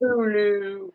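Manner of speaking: high, soft mock Baltimore TOODALOO; Baltimore accent. Friend jumps in imitating the Baltimore accent, high and soft. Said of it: "so the Baltimore accent is".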